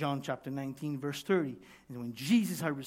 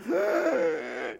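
loud hissing gasp of pain; 5 dB louder than usual. Retold or imitated. Preacher mimics hammering a finger, pained gasp. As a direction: gasp sharply as if the finger was hit.

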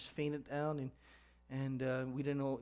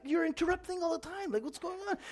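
high-pitched acted exasperation; pitched-up put-upon protest; frustrated husband; 13 semitones higher than usual. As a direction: complain to your wife, fed up with interruptions. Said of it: "looks around getting frustrated".

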